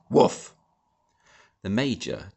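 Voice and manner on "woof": loud short bark; dog bark woof. A narrator's reading.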